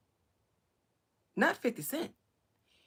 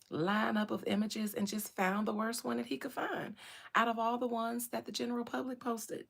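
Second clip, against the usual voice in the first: ordinary delivery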